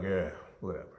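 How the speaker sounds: low, soft mock brush-off; father brushing off his son's boast, low and soft; dismissive dad. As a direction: toss it away low and quiet. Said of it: "And he was like".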